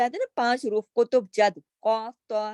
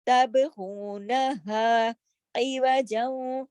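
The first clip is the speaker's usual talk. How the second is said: slow drawn recitation; measured recitation; Quran recitation; much slower than usual. Shown as a demonstration. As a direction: chant it slowly. Teacher recites verse.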